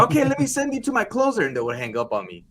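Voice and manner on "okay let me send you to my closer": high, quick phone hand-off; pitched-up mock sales voice; chirpy telemarketer. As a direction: brightly pass the caller along. Acting out handing over caller.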